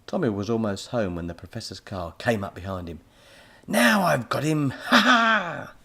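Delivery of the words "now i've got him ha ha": loud gloating villain shout with a laugh; evil yell. A narrator's reading. Professor gloating at Tommy from his car, loud and evil. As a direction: gloat as you catch him. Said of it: "the professor yelled with an evil grin".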